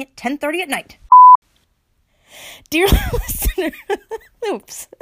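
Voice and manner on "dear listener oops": high giggle breaking the words; cracking up mid-sentence; laughing. Real, not acted.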